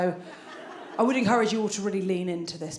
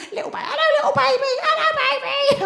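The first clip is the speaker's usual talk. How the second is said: much higher cooing voice with laughter; cooing baby talk at a stranger's baby; 5 dB louder than usual. Retold or imitated. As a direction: coo at the baby like a besotted aunt.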